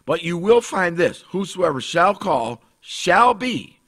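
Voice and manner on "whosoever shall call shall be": slow, leaning hard on "shall"; each "shall" weighted and drawn out; stress on shall. Shown as a demonstration. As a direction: press "shall" as a firm promise. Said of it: "Not might be".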